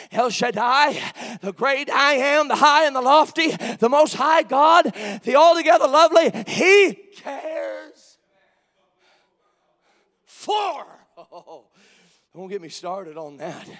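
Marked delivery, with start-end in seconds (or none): 0.1-8.2 loud, high roll call of holy names; full-force preaching. Real, not acted. Preacher proclaiming the Lord of glory, full-force shouted preaching. 10.2-10.9 "for" high, set apart by pauses; stress on for. Shown as a demonstration. Preacher emphasizing each word of the verse, high lone "for". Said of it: "you emphasize each word of that".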